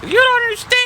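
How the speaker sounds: put-on very high, pouty kid voice; mock teen protest; whiny student. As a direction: whine it like a hurt preteen. Upset student whining.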